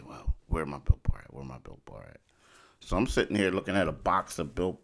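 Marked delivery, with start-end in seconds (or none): 1.3-2.2 lower, soft murmur; quiet, lower repeat. Retold or imitated. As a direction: mutter the question again softly. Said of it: "I look at it and I say".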